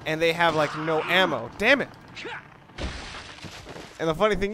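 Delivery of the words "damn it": louder, higher exasperated curse; frustrated outburst. Real, not acted. Player angry at a game mistake, loud exasperated curse.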